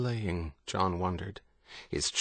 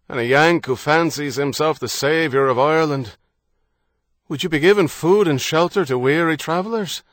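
loud, hearty Irish man calling out; a confident Irishman hailing strangers; bold Irish traveller; 5 semitones higher, 6 dB louder than usual. Acted. Harrody calls his introduction.

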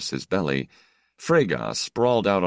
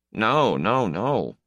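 mild head-shaking no; 6 semitones higher, slower than usual. Acted. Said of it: "he said, shaking his head".

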